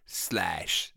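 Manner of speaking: a mock German "Slash."; lightly German "Slash."; German accent. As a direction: bark "Slash." like a hammed-up German. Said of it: "say the word slash the most German".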